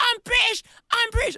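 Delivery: put-on high, whiny child protesting; a bullied boy yelling back in a squeaky voice; furious kid. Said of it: "I reckon he was like".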